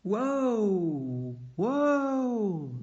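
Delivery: loud, high cries of alarm; alarmed driver yelling at runaway horses; alarmed cry. A narrator's reading. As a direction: shout to halt bolting horses in alarm. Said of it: "the driver cried in alarm".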